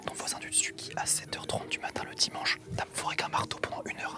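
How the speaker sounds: very soft whisper; conspiratorial whisper. Real, not acted. Co-host describing the noisy neighbour before the prank, whispering. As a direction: spell out the annoyance in a whisper. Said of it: "on parle pas vraiment".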